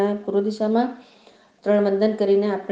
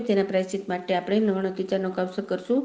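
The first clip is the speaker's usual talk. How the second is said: ordinary delivery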